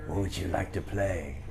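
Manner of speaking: soft, slow imitation of a rock singer; hushed, slowed-down put-on voice. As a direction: imitate the star asking quietly, drawing it out. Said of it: "[name]'s in front of me on the floor".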